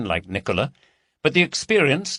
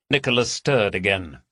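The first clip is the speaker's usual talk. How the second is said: usual speaking voice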